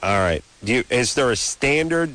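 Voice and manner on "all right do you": usual speaking voice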